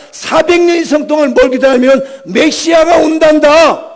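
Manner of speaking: high, driving pulpit voice; full-force preaching. Real, not acted. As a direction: preach with urgent, sustained intensity.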